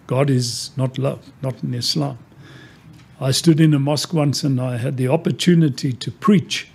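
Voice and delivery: ordinary talk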